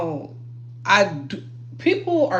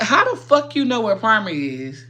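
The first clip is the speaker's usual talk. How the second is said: ordinary delivery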